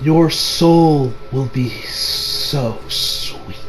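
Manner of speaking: low, soft, very slow menace; hushed, lingering villain voice; sinister leader. Retold or imitated. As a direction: draw it out quietly like a creepy villain. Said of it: "He says".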